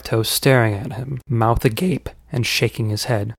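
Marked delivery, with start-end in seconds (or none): none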